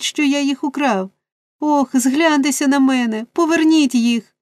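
put-on high, imploring boy's plea; desperate pleading. A narrator's reading. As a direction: beg the old man's return like a child in despair. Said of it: "в нестямному розпачі".

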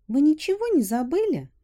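put-on high sly young girl question. A narrator's reading. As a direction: ask it slyly, high and girlish. Girl voice asks slyly.

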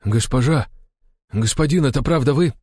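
high, incredulous, halting question; stunned. A narrator's reading. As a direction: address them as if seeing ghosts. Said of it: "ошарашенно пробормотал". (изумлённо, не веря)

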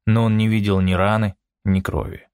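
plain reading voice

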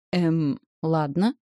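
slow, puzzled drawn-out words; puzzled drawl. A narrator's reading. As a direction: drawl it out in confusion. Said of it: "недоуменно протянула Наталья".

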